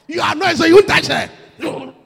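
loud mock threat of burning; preacher teasing husbands with doom; half-laughing warning. Real, not acted. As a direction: warn them with a laugh in the voice.

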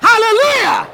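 shouted hallelujah. Real, not acted. Preacher calls out to the church.

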